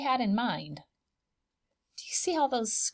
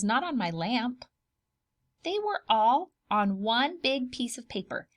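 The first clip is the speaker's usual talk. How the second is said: plain reading voice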